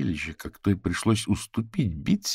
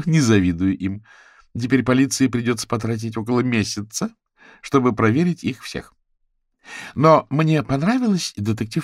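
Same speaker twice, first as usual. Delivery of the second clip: higher, louder animated gossip; lively, higher-pitched grumbling, easing off; candid, chatty Bitsy; 6 semitones higher, 6 dB louder than usual. A narrator's reading. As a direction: recount it to friends with wry exasperation.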